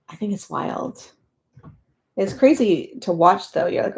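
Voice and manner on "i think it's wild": whispered. Real, not acted. Soft aside about fast puzzlers.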